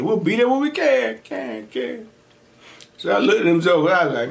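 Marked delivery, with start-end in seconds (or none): 0.0-2.1 echo. Retold or imitated. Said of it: "It echoed in my head".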